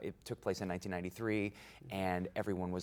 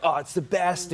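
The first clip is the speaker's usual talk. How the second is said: excited; 9 semitones higher, 8 dB louder than usual. Retold or imitated. Performer's rush before live audience.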